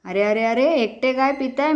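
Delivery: ordinary talk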